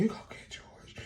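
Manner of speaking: whispered, airless comeback; a winded fighter's feeble challenge; imitating out of breath. Exhausted fighter telling rival to get George, mock breathlessness. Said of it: "even more out of breath".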